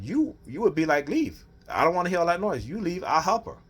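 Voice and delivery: high mocking imitation of the wife. Husband mocking wife shooing him away, high and mocking.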